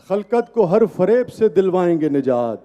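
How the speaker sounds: measured, emphatic verse recitation; poetry recital. Acted. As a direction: recite it to a live audience. Recites ghazal at mushaira.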